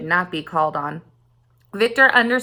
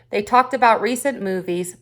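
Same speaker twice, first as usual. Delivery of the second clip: plain reading voice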